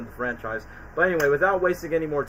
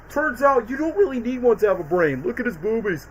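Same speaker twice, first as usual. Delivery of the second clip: lightly voiced dim cartoon blob, pitched up; Bob impression; 7 semitones higher than usual. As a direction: play the clueless blob from the film. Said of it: "Bob has the best lines".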